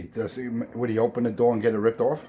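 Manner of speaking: usual speaking voice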